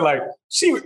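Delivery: usual speaking voice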